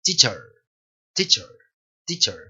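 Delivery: "teacher" with only the tongue shaping a faint last vowel; tongue-led weak ending. Shown as a demonstration. Teacher drilling the word, correct weak ending. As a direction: repeat "teacher" with a nearly silent E. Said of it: "La lengua, la lengua en este punto".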